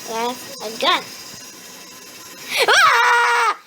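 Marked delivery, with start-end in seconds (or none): 2.7-3.6 startled shout. Real, not acted. Monster appears.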